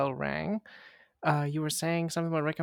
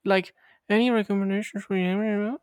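mock mumble; 5 semitones higher, 4 dB louder than usual. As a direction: imitate a garbled, hard-to-hear question. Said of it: "Why are you getting so quiet?".

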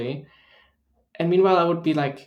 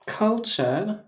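higher questioning British echo; mock British accent; 6 semitones higher than usual. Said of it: "we haven't done British accents on here".

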